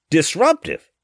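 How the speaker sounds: high, loud outraged yell. A narrator's reading. Wife yells the word back at the official, high and loud. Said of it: "she yelled".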